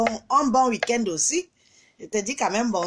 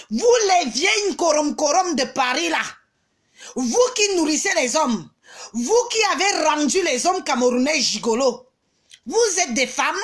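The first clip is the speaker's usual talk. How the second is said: raised pitch, indignant scolding; angry tirade; 3 semitones higher than usual. Real, not acted. Streamer berating the women of Paris who gossip, angry.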